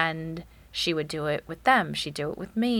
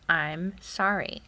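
slow teaching model; slower than usual. Shown as a demonstration. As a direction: slowly spell out sorry for a child, "I'm sorry" said in full. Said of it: "you say".

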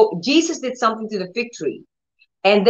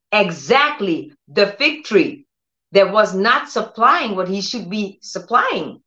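usual speaking voice

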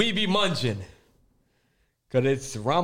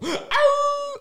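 wolf howl; 5 dB louder than usual. Acted. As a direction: gasp then high howl. Gasp then howl.

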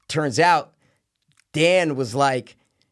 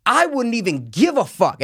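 higher, emphatic telling-off; indignant tone; 9 semitones higher, 3 dB louder than usual. Retold or imitated. Golfer telling off careless players, indignant. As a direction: re-enact your indignation, pitched up. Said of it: "this is the tone I'm saying".

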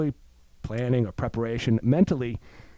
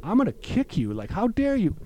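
mock outrage; 8 semitones higher than usual. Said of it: "My first thought is like".

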